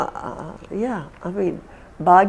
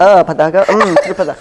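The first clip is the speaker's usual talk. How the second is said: louder, laughing through the words; laughing recital; 7 dB louder, much slower than usual. Real, not acted. Interviewer reciting mudras back to the teacher, laughing. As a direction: giggle through the list.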